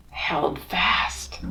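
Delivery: slow, hushed storybook whisper. Acted. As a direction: breathe it out slowly for suspense.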